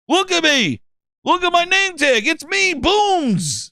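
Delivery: very high, eager gamer voice; attention-hungry fan. Pretend. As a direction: crow your own name, stretching it out high. Attention-hungry fan shoving in on host.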